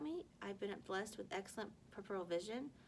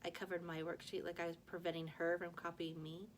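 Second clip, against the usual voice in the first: ordinary delivery, nothing marked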